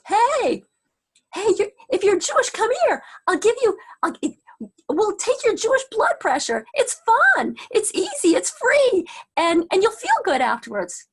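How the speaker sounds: loud, high hawker's call; acted-out insistent barker's spiel, easing at the end; eager street pitch. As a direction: shout to coax a passer-by over. Street recruiter hails passer-by.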